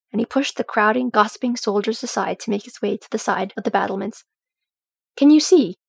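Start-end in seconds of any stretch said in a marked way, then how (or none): 5.2-5.8 high, loud call; shouted. A narrator's reading. Dori calling to Dwalin on the battlements, shouting. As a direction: call out urgently to someone along the wall. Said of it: "Dori shouted to him".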